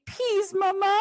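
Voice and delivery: put-on toddler voice. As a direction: plead like her small daughter. Imitating her toddler daughter.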